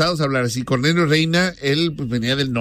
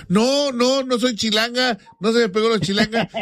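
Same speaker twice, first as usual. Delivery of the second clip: put-on higher, indignant young woman denying; girl from Monterrey; 7 semitones higher than usual. Monterrey girl denying she sounds chilango, higher indignant. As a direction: protest it in a higher voice. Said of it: "ya se te está pegando los chilangos".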